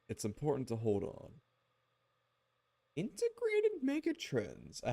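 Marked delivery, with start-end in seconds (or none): 3.0-4.8 stretched out, high silly squeal; put-on squeaky drawn-out voice; mock high voice. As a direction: read it slowly way up high. Reads a name in silly voice.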